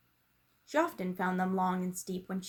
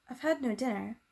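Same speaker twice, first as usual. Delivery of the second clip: ordinary delivery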